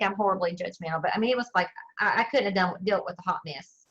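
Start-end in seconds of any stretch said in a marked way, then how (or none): none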